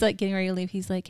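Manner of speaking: ordinary delivery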